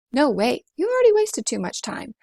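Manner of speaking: high and loud, scolding; impatient inner voice. Acted. Inner voice scolding Rosie for lost time, high and loud. Voice arguing against the dwarves.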